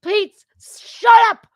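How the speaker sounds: loud, slow plea to shut up; fed up, yelling over the squawking; slow exasperated yell at the noisy reporters; exasperated shout. Retold or imitated. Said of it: "It's like".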